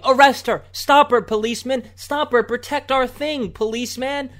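high, loud mock yell; hammed-up demand for the police; mocking protesters yelling for cops; mock screaming leftist. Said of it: "they're out there screaming".

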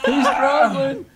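slow, high, laughing; laughing commentary. Real, not acted. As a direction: laugh through the words. Cheering on push-ups, laughing.

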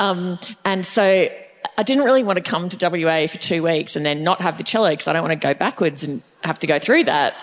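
ordinary talk, nothing marked

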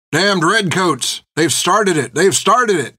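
high, slow-drawn alarm cry; rousing town-crier alarm, pitched high. A narrator's reading. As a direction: cry the news out to a crowd. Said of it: "he cried".

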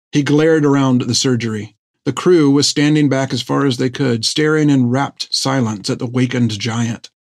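plain reading voice

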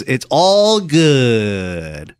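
very slow, stretched-out "all good"; imitated drawn-out delivery. Homeless man brushing it off, in an exaggerated slow drawl. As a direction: draw each word right out, mimicking him. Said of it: "That's how he said it".

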